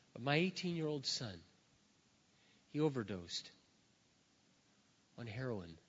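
low, soft, halting retelling; understated grief. Retold or imitated. Parent phoning the pastor about a son's overdose, understated retold grief. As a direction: break the news quietly, with pauses.

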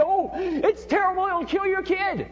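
high, slowed, mocking fret; a critic's mock panic over the kids, pitched high; mock alarm. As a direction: parody a hand-wringing scold, high and slow. Mocking critics of kids' creativity.

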